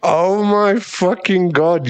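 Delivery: delighted shock. Acted. Guy catches the girl blushing, gleeful shock. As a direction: stretch it out in triumphant disbelief. She blushes at his teasing.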